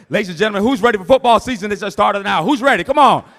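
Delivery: loud, high crowd hype; hype shout. Real, not acted. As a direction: fire up the room. Hyping crowd before bidding.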